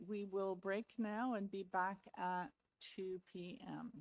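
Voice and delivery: ordinary delivery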